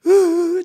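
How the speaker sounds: imitated sob. Elder crying in the parking lot.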